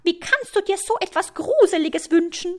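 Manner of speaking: high, loud spider in mock horror; shrieky high-pitched reproach; horrified screech. A narrator's reading. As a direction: screech it, utterly appalled. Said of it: "kreischt die Spinne".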